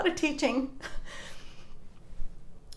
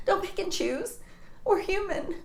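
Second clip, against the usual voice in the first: normal talking voice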